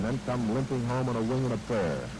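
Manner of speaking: ordinary talk, nothing marked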